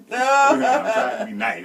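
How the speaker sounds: laughing hard, high and slow. Real, not acted. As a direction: squeal it through laughter. Laughing through repeat.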